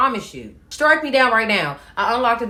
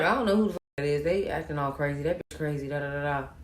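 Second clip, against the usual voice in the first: low, quick, softer brush-off; dismissive denial; 6 semitones lower, 8 dB quieter, faster than usual. Retold or imitated. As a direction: imitate someone hurriedly playing dumb. Man feigns ignorance, retold.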